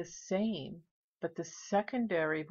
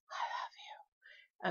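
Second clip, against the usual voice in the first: soft unvoiced whisper; spirit whisper; 8 dB quieter, slower than usual. Vlogger imitates the ghost saying it, whispering. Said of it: "someone whispers".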